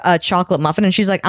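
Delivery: ordinary delivery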